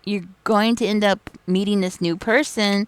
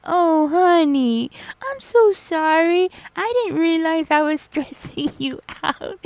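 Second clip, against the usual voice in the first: much higher, slow, soothing apology with a laugh; sweet old patient; 10 semitones higher, 3 dB louder than usual. Retold or imitated. Patient Irene apologizing to her crying nurse, high sweet coo. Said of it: "she was all like".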